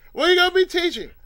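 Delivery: high, fast quiz; eager coworker. Retold or imitated. Coworker quizzing the teacher, high and quick. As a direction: fire the question eagerly.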